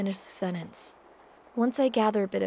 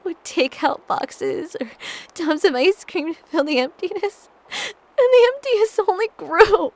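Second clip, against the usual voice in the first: sobbing; 10 semitones higher, 8 dB louder than usual. Acted. Breaking down over the emptiness.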